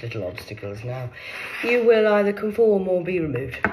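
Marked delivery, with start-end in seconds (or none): 1.6-3.6 loud, commanding ultimatum; loud declaration. Real, not acted. Ranting at her accusers, loud and commanding. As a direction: lay down the law, loud.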